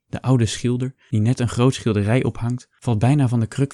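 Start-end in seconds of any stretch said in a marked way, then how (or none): none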